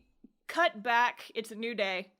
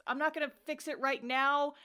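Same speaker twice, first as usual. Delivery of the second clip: irritated rant; 5 semitones higher than usual. Retold or imitated. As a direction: snap it out, fed up. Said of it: "He's so irritated".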